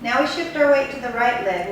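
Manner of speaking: usual speaking voice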